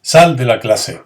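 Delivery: firm spoken order to leave; teacher demoing a command. Shown as a demonstration. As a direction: order the student out of class. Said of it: "la acompañaríamos también de los llamados signos de exclamación".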